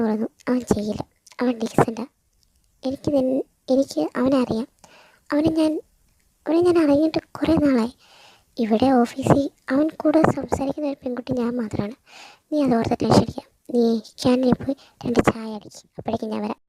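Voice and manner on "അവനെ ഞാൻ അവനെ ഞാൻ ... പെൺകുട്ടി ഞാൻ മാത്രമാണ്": plain reading voice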